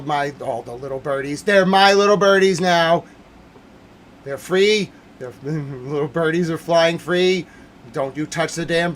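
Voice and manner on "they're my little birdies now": loud declaration. Real, not acted. Ranting streamer shouting.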